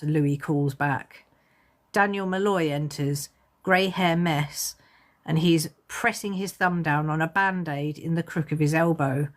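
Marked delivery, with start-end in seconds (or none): none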